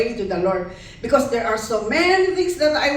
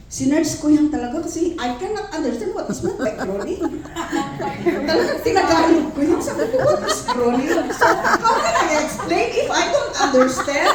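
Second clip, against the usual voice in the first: laughing; 6 semitones higher, 4 dB louder than usual. Real, not acted. Laughing at her own confusion.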